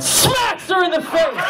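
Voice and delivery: high, slow, laughing shout; shouted punchline. Real, not acted. Comic telling the audience how the bag of quarters hit her. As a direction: belt out the moment of impact.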